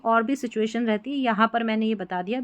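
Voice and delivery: normal talking voice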